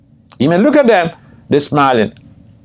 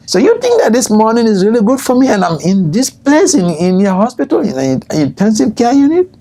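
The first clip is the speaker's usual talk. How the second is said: raised-pitch mock indignant question; acted incredulous challenge; 8 semitones higher than usual. Patient challenging nurse's good morning in intensive care, incredulous question. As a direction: build to a high questioning finish.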